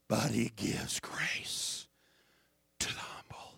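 hushed, slow unvoiced whisper. Real, not acted. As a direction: drop to a whisper for effect. Quoting scripture in a whisper.

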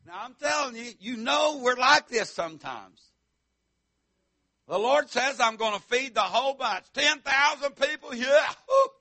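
full-force preaching. Acted. Preacher shouting faith to the congregation, loud and high.

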